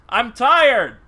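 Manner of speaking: loud, emphatic exasperated declaration; tired exasperation. Real, not acted. Sleepless player explaining saltiness, tired exasperation. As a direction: belt out how worn out you are, drawing out the second take.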